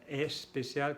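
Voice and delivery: "especial" opening on a stressed E before S; added leading E. Shown as a demonstration. Teacher imitating students' error, "especial" with E in front. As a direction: lean on an extra E at the start of "especial". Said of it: "si ustedes dicen especial".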